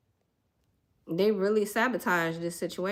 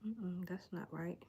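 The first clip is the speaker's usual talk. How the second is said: whisper to self; 16 dB quieter than usual. Real, not acted. Muttering to herself off-mic.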